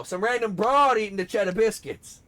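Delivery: high, loud character impression; hammed-up cartoon outburst; Riley. Showing partner the character with a quote, loud Riley impression. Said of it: "bring out the Riley".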